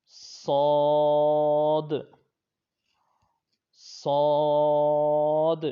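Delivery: صاد long, thick and loud with a whistle; heavy whistling saad. Shown as a demonstration. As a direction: stretch صاد full and heavy. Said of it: "اس کو موٹا بھی پڑھیں گے". (صاد موٹا اور لمبا)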